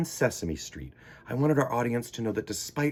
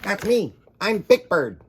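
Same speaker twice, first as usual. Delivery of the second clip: put-on higher, louder childlike bird; a light childlike bird greeting; Big Bird voice; 8 semitones higher, 6 dB louder than usual. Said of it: "big yellow bird who was a child".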